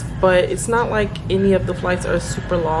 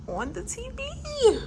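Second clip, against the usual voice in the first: high thrilled squeal; excited squeal; 7 dB quieter than usual. Real, not acted. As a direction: squeal it with delight. Sees her name on hotel TV.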